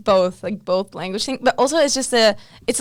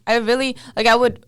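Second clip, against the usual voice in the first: normal talking voice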